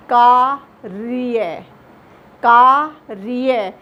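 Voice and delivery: कार्य said very slowly, the र rolled; slow demo pronunciation. Shown as a demonstration. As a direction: spell out कार्य slowly, syllable by syllable. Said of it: "जब हम इसका उच्चारण करेंगे". (कार्य को बहुत धीरे, र पर ज़ोर देकर)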